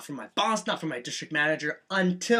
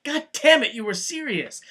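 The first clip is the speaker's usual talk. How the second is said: surprised buddy; 8 semitones higher than usual. Retold or imitated. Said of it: "he was like".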